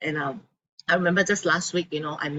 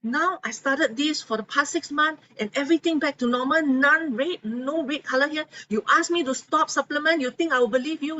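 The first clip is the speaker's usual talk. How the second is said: higher, indignant retort; angry patient; 7 semitones higher than usual. Retold or imitated. Patient tells her doctor off for the first time, indignant. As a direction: throw it back at the doctor in mock outrage. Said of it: "she was so angry with the doctor".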